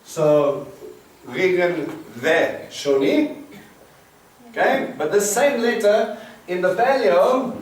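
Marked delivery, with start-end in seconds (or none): 1.2-3.4 Regen Ve Shoni drawn out slowly and carefully; careful slow Hebrew reading; slow Hebrew demo. Shown as a demonstration. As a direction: sound out Regen Ve Shoni for the class. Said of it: "So the oo can represent and".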